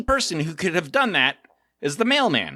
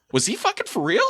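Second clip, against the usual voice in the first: incredulous; 8 semitones higher than usual. Retold or imitated. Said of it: "I was like".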